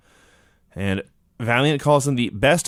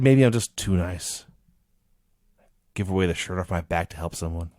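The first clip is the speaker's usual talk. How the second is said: sad and morose; 8 semitones lower, 5 dB quieter than usual. Retold or imitated. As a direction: imitate a downcast man, sinking quieter. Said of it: "This was sad and morose".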